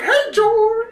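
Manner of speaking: mock-sweet falsetto greeting; gushing hairdresser. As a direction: squeal his name like a favourite. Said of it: "she's like".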